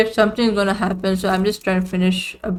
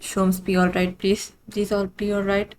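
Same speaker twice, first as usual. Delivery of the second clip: hushed pleading; 8 dB quieter than usual. Real, not acted. Player hoping Sholmes is all right, hushed plea. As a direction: plead under the breath.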